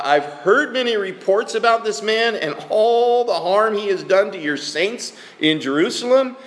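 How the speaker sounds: an anxious servant objecting to God, a touch acted; reading with added inflection. Preacher voicing Ananias objecting to God, mild pleading. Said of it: "I'm gonna add my own voice flexions".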